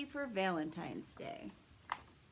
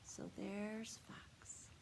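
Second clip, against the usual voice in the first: slow hushed whisper; 11 dB quieter, slower than usual. Real, not acted. Teacher telling the children about the sneaking fox, slow whisper. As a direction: whisper it like a secret. Teacher aside to kids, secretive.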